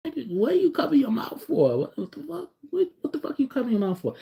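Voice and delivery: exasperated outburst. Retold or imitated. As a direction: snap at him for mumbling. Mocking the stammer, annoyed.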